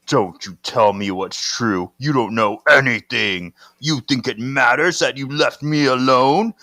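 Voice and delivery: imperious monster voice, building to loud proclamations; possessed ancient being. Acted. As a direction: declaim it as an ancient menacing power. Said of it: "You better change your voice".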